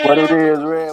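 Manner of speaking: loud, hyped greeting. Real, not acted. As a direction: call it out loud.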